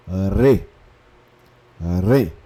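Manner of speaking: "re" with a throaty R and open e; syllable re. Shown as a demonstration. Teacher sounds out the syllable re. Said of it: "Então".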